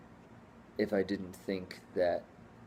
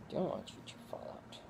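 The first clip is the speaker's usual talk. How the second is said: whisper; 6 semitones higher, 12 dB quieter, faster than usual. Real, not acted.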